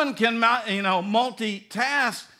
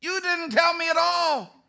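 protesting husband; 6 semitones higher, 5 dB louder than usual. Retold or imitated. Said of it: "And you go".

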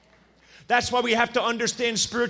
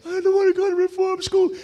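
higher, whiny little-kid wail, a touch of mock tears; crying little brother; 9 semitones higher than usual. Little brother George pleads about reform school, higher whiny kid voice. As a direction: play a scared five-year-old near tears. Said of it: "My brother starts crying".

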